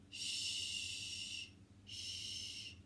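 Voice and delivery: soft hushing shh sound; whispered shh. Acted. Snow hushing the listening owl, whispered. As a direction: hush like falling snow.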